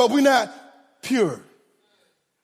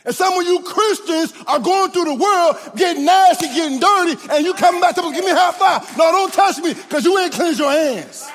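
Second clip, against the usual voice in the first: rising preaching; 5 semitones higher than usual. Real, not acted. Preacher raising voice.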